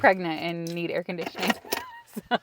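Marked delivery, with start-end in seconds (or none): 1.6-2.2 soft laugh. Real, not acted. Laughing about being pregnant.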